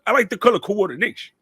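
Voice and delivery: excited, fast quoted voice; quick, punchy Taz impression; imitating Taz. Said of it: "he was like".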